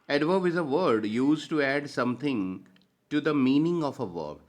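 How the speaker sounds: teacher dictating a definition; slow dictation. Acted. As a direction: read the definition out word by word for notes.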